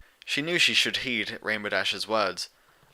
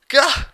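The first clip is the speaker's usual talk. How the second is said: high, loud startled yelp; startled yell; 10 dB louder than usual. A narrator's reading. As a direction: jump and yelp in fright. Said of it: "Dash yelled".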